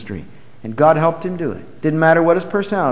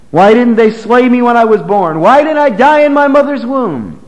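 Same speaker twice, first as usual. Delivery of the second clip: higher and louder, a cry of despair; anguished outcry; 7 semitones higher, 4 dB louder than usual. Acted. Preacher voicing Jeremiah crying out against his birth, anguished and higher. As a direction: wail it out as a man who wishes he were never born.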